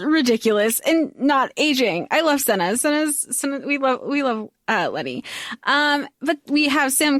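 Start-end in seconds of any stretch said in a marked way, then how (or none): none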